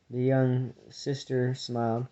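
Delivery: plain reading voice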